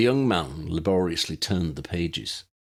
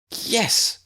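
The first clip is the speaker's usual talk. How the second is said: breathy, much higher eager yes; 4 dB louder than usual. A narrator's reading. Lydia agrees eagerly, breathy and high. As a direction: gasp it out, delighted.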